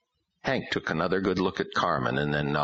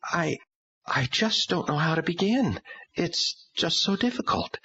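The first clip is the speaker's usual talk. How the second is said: hesitant woman. A narrator's reading. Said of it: "very difficult time".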